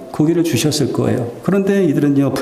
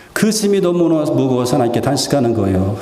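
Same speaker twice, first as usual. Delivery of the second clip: ordinary talk, nothing marked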